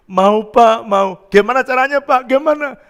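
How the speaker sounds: high, loud, urgent asking; imitated eagerness. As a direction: act out an eager man begging to know how. Neighbour eagerly asks how.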